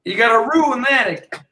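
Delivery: very high mock-Australian call; Australian accent. Repeating the joke for Australian host, high mock Australian accent. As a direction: send the Aussie accent sky-high. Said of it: "That's a good accent".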